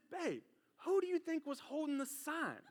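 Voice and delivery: retold quiet disbelief. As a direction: ask softly, as if stunned she missed it. Retelling his stunned question.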